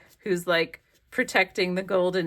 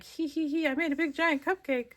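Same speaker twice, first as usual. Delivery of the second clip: higher, giggling proud little voice; giggly gloat; 9 semitones higher, 3 dB quieter than usual. Acted. Collage figures giggling over their giant cupcake, high and gleeful. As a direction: giggle it out, pleased with yourself. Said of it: "they're like".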